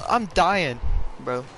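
normal talking voice

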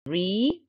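re said alone with a held vowel; isolated syllable. Shown as a demonstration. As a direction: set re apart as an open syllable. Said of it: "open syllables". (re كمقطع مفتوح منفصل)

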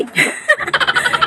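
high squealing laugh; shrieking laugh at the comment; burst of laughter. Real, not acted.

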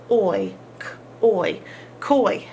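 "oi, k, oi, koi" blended sound by sound. Shown as a demonstration. Teacher blending sounds for the class, sounding out. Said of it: "There's our diphthong".

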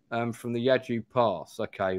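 normal talking voice

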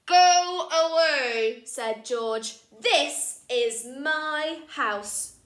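slow, loud, bossy cry; bossy shout. Acted. George ordering Rashida away, slow and loud. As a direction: drag out the order.

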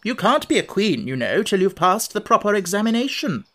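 imperious challenge. A narrator's reading. Red Queen challenging Alice's right to the title, imperious. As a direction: lay down the rules imperiously.

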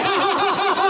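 high, hearty demo laugh; laughter yoga laugh. Shown as a demonstration. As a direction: show the class a big open laugh. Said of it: "శరీరం ఊరికే నవ్వాలి". (గట్టిగా నవ్వు)

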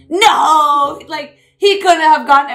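loud, high mock protest; Hannah. Teasing friend by re-enacting her game outburst, mock-indignant. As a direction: imitate a friend objecting hotly over a game.

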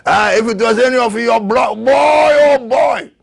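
put-on high, loud exclamation, slowing on boy oh boy; excited preacher's outcry; preaching shout. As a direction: shout it at the congregation.

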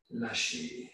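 soft, airy "let go"; a hushed, whispery release; releasing exhale. Real, not acted. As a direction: breathe the cue out softly. Cue to release tension, exhaling.

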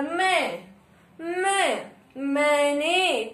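मैंने drawn out very slowly through nose and mouth; nasal vowel demo. Shown as a demonstration. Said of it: "नाक और मुख दोनों का प्रयोग". (मैंने को धीरे, नाक और मुख से)

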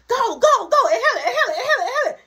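high, loud, laughing cheer; laughing yell. Retold or imitated. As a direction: yell them on through laughter. Friends yelling him on.

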